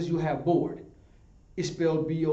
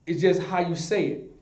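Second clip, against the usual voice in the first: usual speaking voice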